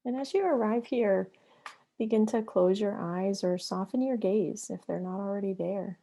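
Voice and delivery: unhurried meditation guide with long pauses between phrases; guided meditation. Acted. Yoga therapist guiding listeners into breath and flow, guided meditation. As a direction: lead them into the breath, leaving long silences.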